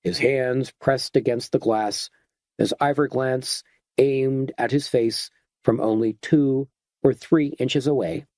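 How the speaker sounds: usual speaking voice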